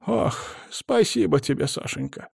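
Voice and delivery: put-on high, wailing old woman; plaintive granny lament. A narrator's reading. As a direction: voice her as a fretful grandma. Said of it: "вновь запричитала бабуля".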